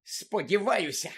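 slow, high, seething outburst; furious місіс Фік. A narrator's reading. Old woman cursing the vanished lookout, furious. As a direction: spit the wish out angrily. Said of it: "розлючено гаркнула місіс Фік".

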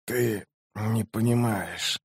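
slow, very soft wounded man's voice; dying mage. A narrator's reading. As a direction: labour quietly through each word. Dying mage answers sorceress. (медленный тихий голос умирающего)

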